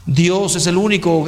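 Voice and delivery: ordinary talk, nothing marked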